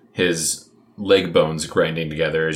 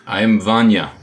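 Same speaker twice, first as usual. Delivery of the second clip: put-on Russian-style accent; 2 semitones higher, 5 dB louder than usual. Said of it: "I've been practicing this accent all day long".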